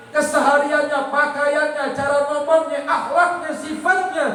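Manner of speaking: high, ringing sermon voice climbing through the list; fervent preaching. Acted. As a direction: preach it out to the crowd, building. Preaching at full force. (ceramah berapi-api dengan nada tinggi)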